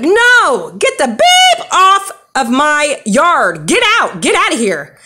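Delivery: loud, high yelling; furious yell. Retold or imitated. Woman shouting a stranger off her yard, furious. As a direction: shout him off the yard, slow and hard. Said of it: "And I was like".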